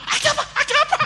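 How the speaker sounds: very high, loud alarmed husband; startled cry. Acted. Husband startled as if by an earthquake, shrieking in mock alarm. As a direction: yelp it in comic alarm.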